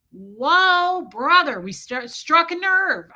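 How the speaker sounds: high, loud "whoa", then drawn out; teasing, reenacted alarm; mock taken aback. Retold reaction to touchy man.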